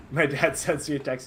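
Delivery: ordinary talk, nothing marked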